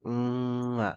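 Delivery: playful "mwah" kiss; kiss sound. A narrator's reading. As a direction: blow a smacking kiss down the phone. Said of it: "सगळीकडे किस करतो आता".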